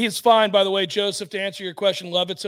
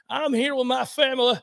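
ordinary talk

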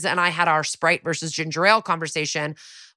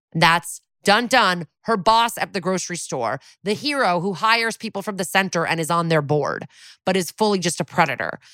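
ordinary delivery

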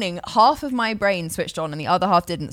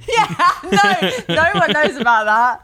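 laughing; 6 semitones higher, 6 dB louder, slower than usual. Real, not acted. Laughing at embarrassing memory.

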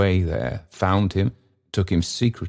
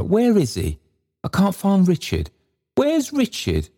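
much higher, distressed searching voice; plaintive moan; 13 semitones higher than usual. A narrator's reading. Trotty searching the company for Richard, moaning. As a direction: call out in a high, pleading moan. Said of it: "moaned Trotty, roaming among the company".

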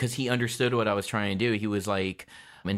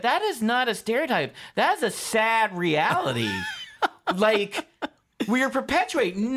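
laughing retort; 11 semitones higher than usual. Real, not acted. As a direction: shout the comeback, laughing. Retort to the critics, half-shouting.

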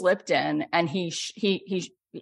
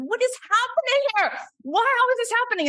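astonished. Retold or imitated. Scientists seeing cancer cells change, high astonishment. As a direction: exclaim like you just saw a miracle. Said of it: "And we said".

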